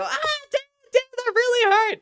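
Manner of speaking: very high, loud cry of hurt; hammed-up squealing protest; mock pained squeal. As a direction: whine it out in exaggerated pain. Acts being hurt by Dan.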